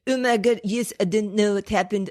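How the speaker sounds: hammed-up French accent; badly faked French speaker; fake French accent. Shown as a demonstration. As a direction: put on a thick, silly French accent. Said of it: "But a French a".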